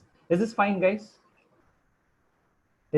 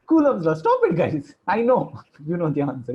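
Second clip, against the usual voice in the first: exasperated protest; 2 semitones higher, 5 dB louder than usual. Real, not acted. Teacher stopping students who answer too soon, exasperated. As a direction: cut them off, raised voice.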